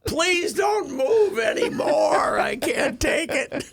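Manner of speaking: high, slow, loud mock wail; hammed-up shriek breaking toward laughter; screaming for mercy. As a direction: stretch it out as a comic agonized plea. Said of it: "the jeans were screaming for mercy".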